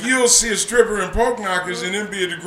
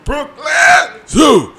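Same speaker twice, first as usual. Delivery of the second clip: high, loud and slow mock announcement; loud drawn-out punchline; 11 semitones higher, 10 dB louder, much slower than usual. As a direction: belt it out slowly. Repeating the punchline loudly.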